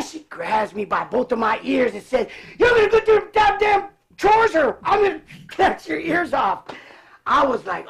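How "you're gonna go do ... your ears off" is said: higher and louder, hammed-up yelled threat; mock threat. Sister yanking his ears to make him do chores, mock yelling. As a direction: play the furious big sister, half laughing.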